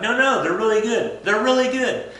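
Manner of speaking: high loud eager coaxing; pushy nobles urging. Retold or imitated. Nobles coaxing Rasputin to eat, high and loud. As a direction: push the cookies on him, over-eager. Nobles pushing poisoned cookies.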